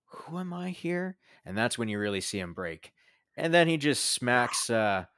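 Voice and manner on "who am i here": confused Jerry. Retold or imitated. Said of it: "He's like".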